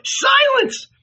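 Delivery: very high, loud cry to silence him; sharp command. A narrator's reading. Magdalene hushing the old man, commanding. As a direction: cut him off with a shrieked order. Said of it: "Magdalene commanded".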